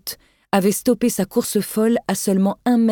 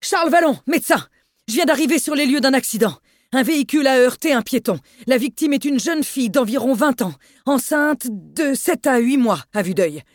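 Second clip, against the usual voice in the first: higher, rushed call over the storm; hurried shouting; 7 semitones higher than usual. Acted. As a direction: shout the report fast into the phone. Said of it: "dans un débit précipité, cria par-dessus le désordre sonore".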